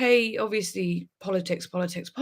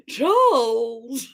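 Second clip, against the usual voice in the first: mock call; 2 semitones higher, 4 dB louder than usual. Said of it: "she's called Charles".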